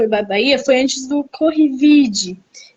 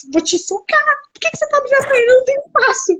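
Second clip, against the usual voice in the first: high-pitched playful whining complaint; mock squabble; 11 semitones higher, much faster than usual. Guest re-enacting cramped sleeping with friends, mock squabble. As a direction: squabble in a high, put-on huff.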